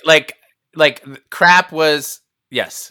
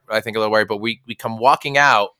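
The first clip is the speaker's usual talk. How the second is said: ordinary talk, nothing marked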